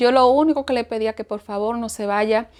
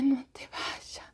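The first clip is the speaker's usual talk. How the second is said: breathless whisper, barely audible; whispered plea; 13 dB quieter, much slower than usual. Retold or imitated. Paralysed woman begging her husband to stay, whispering. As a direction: whisper it as if you can hardly speak. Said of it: "que él apenas podía escuchar".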